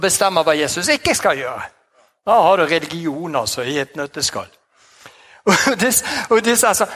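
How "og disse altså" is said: ordinary delivery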